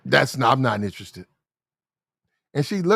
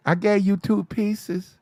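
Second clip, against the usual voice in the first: mock cashier; 6 semitones higher, slower than usual.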